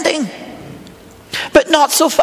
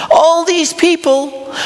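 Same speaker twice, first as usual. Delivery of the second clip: ordinary delivery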